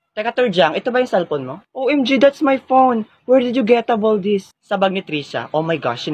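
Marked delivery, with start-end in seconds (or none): 1.7-4.5 slow, high, exaggerated gasp of a line; a spoiled classmate overplaying her shock at the found phone; shocked outrage. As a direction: drag out the outrage like a drama queen. Shown her phone was found.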